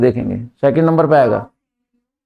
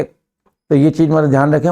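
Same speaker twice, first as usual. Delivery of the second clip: ordinary talk, nothing marked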